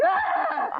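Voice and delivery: high, short shriek; laughing mock scream of alarm; mock startled scream. Comic spooked at mother taking over her body, high shriek.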